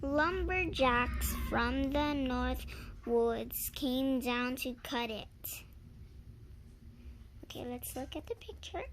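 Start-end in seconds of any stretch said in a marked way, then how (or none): none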